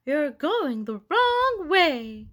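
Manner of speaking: slow, high, drawn-out call. A narrator's reading. Teacher warning Froggy from afar, slow high call. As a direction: stretch "wrong way" across a distance. Teacher calls across the patch.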